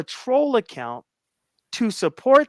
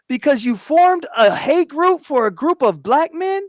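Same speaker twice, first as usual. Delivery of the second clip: higher, louder, incredulous challenge; confronting the self-proclaimed troll, higher and louder in disbelief; heated confrontation; 5 semitones higher, 4 dB louder than usual. Real, not acted. Challenging the troll before the panel, loud and incredulous.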